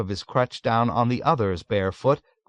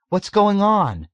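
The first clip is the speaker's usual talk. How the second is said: high, loud, slow demand; 10 semitones higher, 6 dB louder, slower than usual. A narrator's reading. Nurse arriving amid chaos, demanding. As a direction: demand an explanation at once. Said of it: "she demanded".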